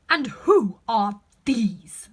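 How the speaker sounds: very slow, loud stern question; imperious demand. Acted. Queen demands who the gardeners are, slow and loud. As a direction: loom over them.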